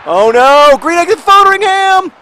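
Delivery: very loud, high-pitched cry; shrieked match-play alarm; alarmed shout. Real, not acted. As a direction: yell the keeper's name in a panic. Alarm during live match play.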